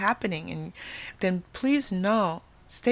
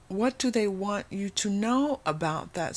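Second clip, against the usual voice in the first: normal talking voice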